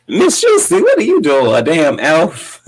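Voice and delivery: very high opening, falling to a drawn-out finish; hammed-up high-pitched jab; Nene imitation. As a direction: mimic a comic put-down, relishing it. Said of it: "And Nene said".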